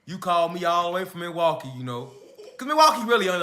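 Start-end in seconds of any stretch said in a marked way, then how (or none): 0.1-2.1 lower, softer, laughing complaint; uncle's voice. Retold or imitated. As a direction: mumble it low like a tired uncle. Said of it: "He like".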